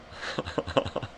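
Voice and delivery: breathy, voiceless laughing; wheezing laugh. Real, not acted. Storyteller amused by the scammer calling back, wheezing laugh.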